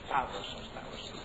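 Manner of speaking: ordinary talk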